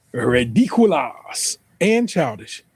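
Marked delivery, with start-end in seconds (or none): 0.1-1.6 "Ridiculous" drawn out as ree-dee-cu-LAS; pronounced as misspelled. Shown as a demonstration. Mocking a commenter's spelling, "Ridiculous" said as ridicu-LAS. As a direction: stretch "Ridiculous" slowly, landing on a long LAS ending. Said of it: "see how they spell ridiculous".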